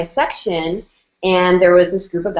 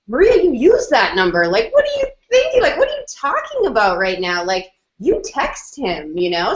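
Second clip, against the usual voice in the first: insistent friend; 6 semitones higher than usual. Retold or imitated. Friend urges her to text.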